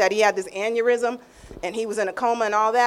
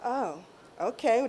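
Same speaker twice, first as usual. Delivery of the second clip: soft surrendering okay; 7 dB quieter, slower than usual. Retold or imitated. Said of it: "I said".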